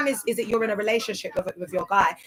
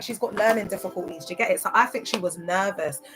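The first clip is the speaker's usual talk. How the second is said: normal talking voice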